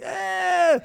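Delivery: loud, high yell of revulsion; short re-enacted shriek of disgust; disgusted scream. Preacher bursting out from under the trailer, disgusted scream.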